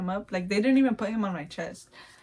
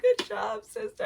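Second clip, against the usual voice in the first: much higher, choked praise; tearful sister; 13 semitones higher than usual. Retold or imitated. Sister congratulating her while crying, much higher. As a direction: praise through tears. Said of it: "She was like".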